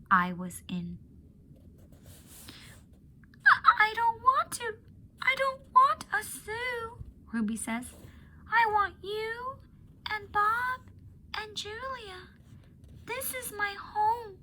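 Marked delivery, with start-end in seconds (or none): 3.5-7.0 put-on high, halting elephant-child voice, pleading; Ruby stammering that she doesn't want the zoo; frightened little Ruby. Ruby telling Ivan she doesn't want a zoo, high and scared. 8.5-14.4 high, slow, close to crying; Ruby begging to stay, voice breaking; tearful little Ruby. Acted. Ruby telling Ivan this is her home, high and tearful. Ruby pleads, voice near tears.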